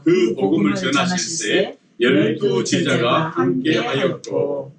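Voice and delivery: slow, measured scripture reading; reading in unison. Real, not acted. Preacher leads congregation in reading verse one, slow unison recitation. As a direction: read slowly so everyone keeps together. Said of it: "우리 다 같이 일 절 읽겠습니다".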